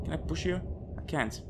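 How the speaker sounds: ordinary delivery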